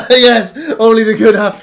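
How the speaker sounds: loud, high, through laughter; uproarious halfling glee; laughing agreement. Acted. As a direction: crow it back, delighted.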